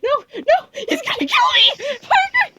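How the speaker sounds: high shrill yell for help; panicked scream. Real, not acted.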